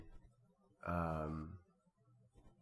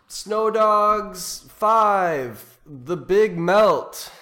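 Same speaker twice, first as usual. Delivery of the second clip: higher, louder, slow trailer-voice title; movie title announcement; 9 semitones higher, 11 dB louder, much slower than usual. Pretend. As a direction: announce it like a cheesy sequel. Pitching a fake movie title.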